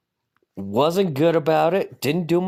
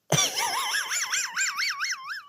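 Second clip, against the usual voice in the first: long high giggle; high laugh; 8 dB quieter than usual. Real, not acted. Laughs at own story.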